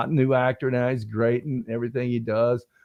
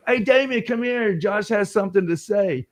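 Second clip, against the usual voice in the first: higher-pitched imitated call to a colleague; calling someone over; 6 semitones higher than usual. Director summons an actor to listen, calling over. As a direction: call him over, voice lifted.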